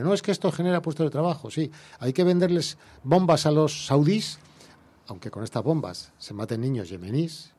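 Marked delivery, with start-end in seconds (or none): 0.0-4.4 higher, insistent voice mimicking arms-sale apologists; raised-pitch parody of an official excuse; mock justification. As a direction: argue it emphatically, as a sarcastic imitation. Mimicking those who justify arms sales.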